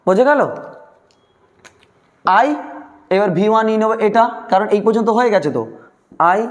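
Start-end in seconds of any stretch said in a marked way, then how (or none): none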